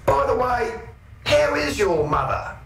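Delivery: high-pitched, needling threat; feigned politeness pitched high over a threat; mock menace. Storyteller warning the blokes off Eric, mock menace.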